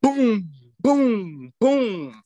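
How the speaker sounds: high, loud booms like thunder; thunderous booms pounding inside; booming. Retold or imitated. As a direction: hammer out each boom like a drumbeat. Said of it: "the way I felt it was like".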